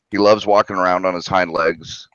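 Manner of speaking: ordinary talk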